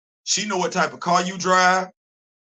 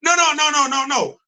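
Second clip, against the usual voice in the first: excited no; 7 semitones higher than usual. Real, not acted. Excited protest.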